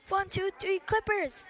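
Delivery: high-pitched huddle cheer; rousing yell at moderate volume; coach's yell. A narrator's reading. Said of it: "yelled Coach".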